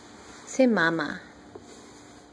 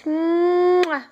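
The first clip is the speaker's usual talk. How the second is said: kiss sound; 4 semitones higher than usual. Real, not acted. Blowing a kiss to baby.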